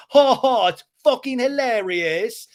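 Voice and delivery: high, loud fake laugh; James's bitter sarcasm. Recapper imitating James ranting, loudly sarcastic. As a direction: imitate a furious man mocking a joke.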